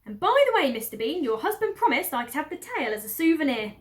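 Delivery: high, loud farm woman; greedy big woman asking for a prize; Mabel's voice. Acted. Farm woman asks her mistress for the fox's tail, high and loud.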